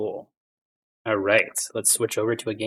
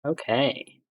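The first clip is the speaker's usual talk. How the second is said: usual speaking voice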